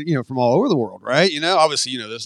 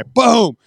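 loud, higher pop of "Boom!" to mimic a slap; slap sound effect; 6 semitones higher, 6 dB louder than usual. Instructor retelling slapping a recruit, a loud mock "Boom!". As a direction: punch out the slap noise.

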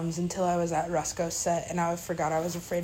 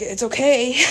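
higher, louder put-on voice through a laugh; laughing clown voice; 6 semitones higher, 8 dB louder than usual. The silent clown forgives her, voiced laughing and higher. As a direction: giggle it out as the clown.

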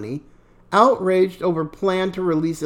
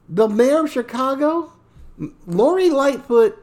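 ordinary talk, nothing marked

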